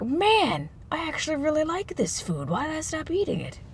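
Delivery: high, soft voice of puzzled rediscovery; wondering realization. Acted. People rediscovering a food they liked, wondering realization.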